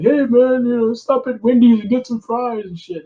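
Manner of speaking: mock the heels being pals on the road; casual wrestler chat.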